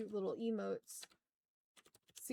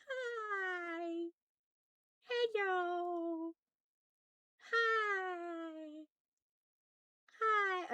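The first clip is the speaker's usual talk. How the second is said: cutesy greeting; 7 semitones higher, much slower than usual. Acted. Greeting chat with a broken model.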